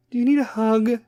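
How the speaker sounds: high mock-caring voice; Kim K. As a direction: simper it like a coddling celebrity. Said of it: "is going to be all Kim K".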